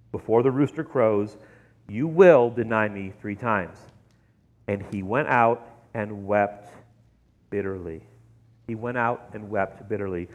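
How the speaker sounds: low, soft, grave narration; hushed sombre reading. A narrator's reading. Preacher reading how Peter wept bitterly, hushed and sombre.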